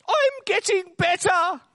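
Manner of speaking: put-on slow, very high comic old man. Old man protesting he is not dead, high comic voice. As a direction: plead it slowly in a high old man's voice. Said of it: "says the old man".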